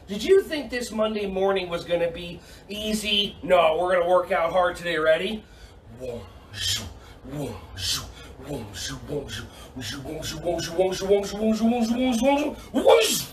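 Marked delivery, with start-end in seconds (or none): 6.0-13.2 laser sound effects. Pretend. Coach testing kids in laser game, building zap sounds. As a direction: start hushed, climb steadily to a burst.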